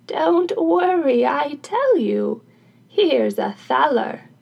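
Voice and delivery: put-on slow, drawn-out old woman's voice; coaxing old crone. Crone bribes carpenter with thaler, slow coaxing voice. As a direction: coax loudly, stretching each phrase.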